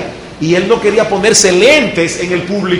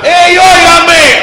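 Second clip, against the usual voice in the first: high, loud, stretched call; projected shout; 11 semitones higher, 7 dB louder, slower than usual. Shown as a demonstration. Teacher demonstrating voice projection, loud call. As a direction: call across the hall from the chest. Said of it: "Yo saqué la voz de aquí".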